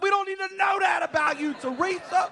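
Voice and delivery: high exasperated shout at the neighbor. Retold or imitated. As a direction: throw it up in disbelief.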